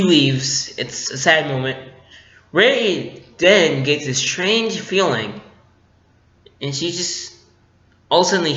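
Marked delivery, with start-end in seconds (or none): none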